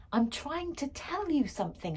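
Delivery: put-on exasperated girl shouting. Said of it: "I shouted".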